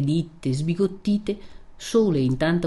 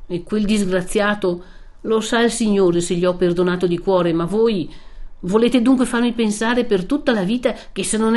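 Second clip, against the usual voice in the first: rising, louder impassioned outburst; Renzo, angry protest; 4 semitones higher, 4 dB louder than usual. Acted. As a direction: refuse her, hurt and furious. (Renzo arrabbiato e ferito)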